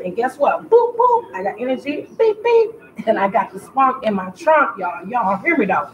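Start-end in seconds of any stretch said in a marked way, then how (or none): 0.7-1.3 playful boop. Acted. Playful sound effect. 2.2-2.7 playful beep. Acted. Playful sound effect.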